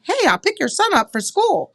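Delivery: high, sing-song super mom voice. Acted. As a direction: chirp it as an eager super mom offering a lift. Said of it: "be that super mom and be".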